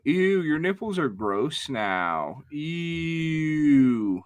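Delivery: drawn-out, grossed-out "ew"; mock disgust. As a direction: recoil in exaggerated disgust, slowly.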